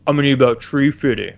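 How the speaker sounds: quick, put-on voice of an old man; Chef's dad voice. Chef's dad asking the kids for money in a put-on voice. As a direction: rattle off the price in a cartoon dad voice. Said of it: "so he'd be like".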